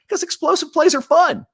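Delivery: jumps way up, loud and thrilled; gleeful burst of enthusiasm; excited. Real, not acted. Gushing about explosive plays.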